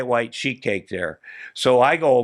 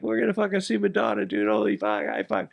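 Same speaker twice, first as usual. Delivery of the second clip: giddy excitement; 9 semitones higher, 5 dB quieter than usual. Retold or imitated. Thrilled about seeing [name].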